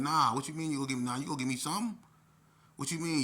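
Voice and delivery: high, loud, fast objection; indignant protest. Retold or imitated. Player to staffer over his missing ice cream, indignant. Protesting he was owed extra.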